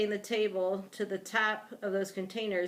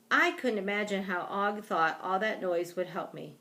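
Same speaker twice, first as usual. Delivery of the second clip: ordinary delivery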